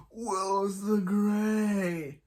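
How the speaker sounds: high, drawn-out grand title; mock wizard announcement. Recapper announces the wizard version of Wells, mock grandeur. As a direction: proclaim it long and high, hammed-up.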